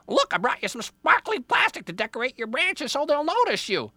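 very high, loud bird voice; eager Jaybird. Acted. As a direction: chatter excitedly like a helpful bird. Jaybird offers decorations.

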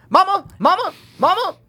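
high, loud panicked yells; screaming Mama. Retold or imitated. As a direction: scream for your mother in panic. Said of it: "him screaming with the scorpion".

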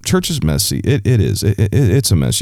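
usual speaking voice

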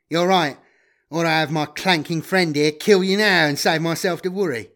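smug betrayer's menace; cocky double-crossing guide. Acted. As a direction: threaten as the one holding the upper hand. Voices Magritte's threat.

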